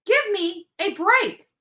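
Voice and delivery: normal talking voice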